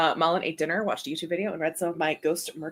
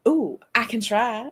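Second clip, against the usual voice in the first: slow Southern drawl, pitched up; higher, drawn-out Southern voice; Southern accent; 4 semitones higher, slower than usual. Pretend. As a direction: try on a playful Southern accent. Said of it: "Can you do a Southern accent?".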